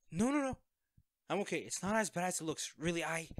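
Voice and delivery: high, loud rushed denial; panicked reassurance. Acted. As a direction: scramble to calm the friend at the door. Caught injured by visiting friend.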